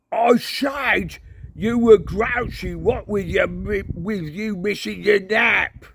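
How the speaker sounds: put-on slow, high old-man shout. Cohen shouting to Mad Hamish, high slow bellow. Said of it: "Cohen shouted".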